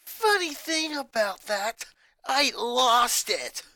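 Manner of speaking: slow, dry sarcasm, leaning on "lost"; sarcastic. Acted. Boy tells his family he lost the suit, slowly and sarcastically. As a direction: drag "lost" out mockingly. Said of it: "Sarcasm was well evident when he said lost".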